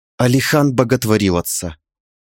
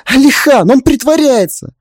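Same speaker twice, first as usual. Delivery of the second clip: high, loud insistent cry; urgent appeal; 12 semitones higher, 4 dB louder than usual. Acted. Wife trying to reach her husband, urgent. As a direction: try hard to get through to him. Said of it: "Попыталась я достучаться до мужа".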